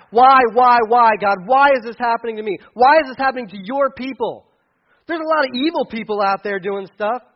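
loud, pleading why shouted upward; crying out. Retold or imitated. Habakkuk crying out to God about his suffering people, loud pleading. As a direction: hurl each why at heaven. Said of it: "Habakkuk was crying out, just saying".

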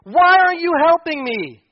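loud, high anguished plea to God; anguished crying out. Retold or imitated. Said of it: "Habakkuk is crying out to God and saying".

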